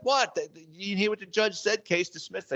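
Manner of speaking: high-pitched indignant squawk, imitating the crook; mock outrage, voice jumping up; pissed-off protest. Crook caught outside court protests to the cop, squeaky indignant. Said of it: "he was pissed 'cause he's like".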